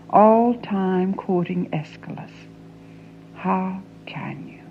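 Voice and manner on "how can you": soft whispered plea; a hushed plea. Acted. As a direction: breathe the question out. Pleading with father.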